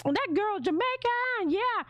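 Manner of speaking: high, slow amazed voice; impressed listener. Retold or imitated. Listener on microphone hearing her, amazed. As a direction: sound like a listener fooled. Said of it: "You'd be like".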